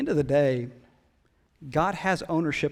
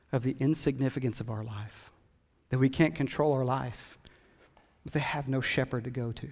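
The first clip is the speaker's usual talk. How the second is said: ordinary talk, nothing marked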